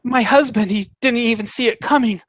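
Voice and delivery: halting, then rising in horror; bewildered survivor, pitch climbing as the horror builds; distraught woman. Acted. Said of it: "and she says".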